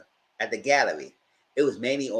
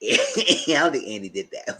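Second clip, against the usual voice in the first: usual speaking voice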